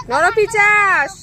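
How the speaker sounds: mother jokingly calls her filthy son a monster; mock insult. Real, not acted. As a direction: stretch the word out to tease.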